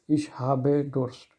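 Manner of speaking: slow, careful ich habe Durst, every syllable clear; slow model. Shown as a demonstration. Teacher gives students the line to repeat, slowly. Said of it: "if you want to repeat it".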